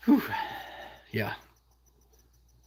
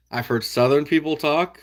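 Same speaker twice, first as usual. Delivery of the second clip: usual speaking voice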